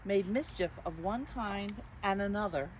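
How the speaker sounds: lightly lilting picture-book narration; reading to children. A narrator's reading. Librarian reading the book to children, gentle storytime. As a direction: read it gently to little listeners.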